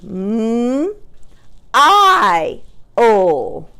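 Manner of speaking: "m, I, o" held as separate sounds; chopped-out word. Shown as a demonstration. Teacher changing the middle sound, chopped apart. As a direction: stretch MILE sound by sound. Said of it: "chop out this word".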